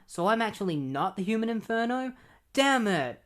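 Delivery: high, hammed-up realisation; cartoon hero's exasperated cry; mock dismay. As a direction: burst out in comic frustration. Dismayed hero, read aloud.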